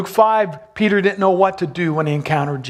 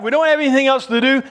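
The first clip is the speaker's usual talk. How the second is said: quick, higher, offhand disciple voice; rushed mock shrug; careless shrug; 7 semitones higher, faster than usual. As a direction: toss it off like bored fishermen with nothing better to do. Disciples' shrug about going fishing.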